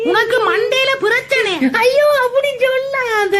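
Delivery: slow, tearful, loud pleading; crying fan begging them to stop; tearful plea. Real, not acted. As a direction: beg through tears. Crying woman.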